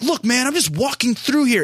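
high put-on mock voice; friend defending himself. Friend talking to the guard, high mock voice. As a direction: brush it off in a high caricature. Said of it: "He's like".

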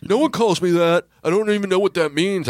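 high, mock-tearful protest; nearly crying. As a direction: imitate a flustered guy on the verge of tears. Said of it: "he's about ready to start crying".

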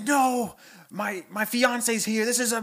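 lightly voiced flustered frog; panicked Kermit at the window, lightly voiced. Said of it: "He's like".

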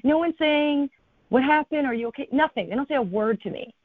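high-pitched disbelief, retelling it; incredulous. Retold or imitated. Storyteller recalling that nobody asked if she was okay, incredulous. Baffled that nobody reacted to tears.

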